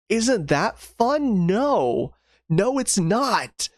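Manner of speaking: slow, drawn-out mock cheer, then a flat no; dry sarcastic question and answer. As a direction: ask it with sarcasm, answer yourself. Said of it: "sarcastic self-answer".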